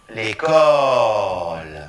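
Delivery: slow, stretched final syllable; lengthened final syllable. Shown as a demonstration. Teacher models slow L'école for repetition. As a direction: draw out the last syllable. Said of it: "Répétons".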